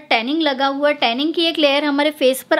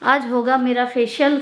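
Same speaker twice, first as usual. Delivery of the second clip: muffled behind cloth mask; talking while removing mask; speaking through a face mask. Real, not acted. Seen 0: Mask over mouth until 1.3s, then pulled off while talking.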